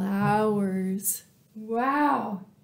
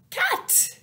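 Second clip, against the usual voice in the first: much higher delighted cry; excited reveal. Acted. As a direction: announce it with a big thrill. Child-friendly reveal of cat.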